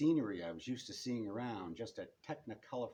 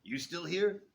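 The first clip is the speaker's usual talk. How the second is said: higher, louder, miffed question; puzzled and miffed; 8 semitones higher, 9 dB louder than usual. A narrator's reading. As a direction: sound put out that he's still there. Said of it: "puzzled and miffed".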